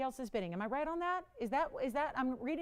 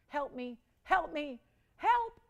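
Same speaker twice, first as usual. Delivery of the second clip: mock plea for help; 3 semitones higher, slower than usual. Host begs viewers for bids.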